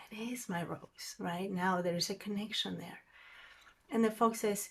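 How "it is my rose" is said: soft unvoiced whisper, softer. Prince realizing his rose is special, whispered. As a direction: breathe it out as a whisper.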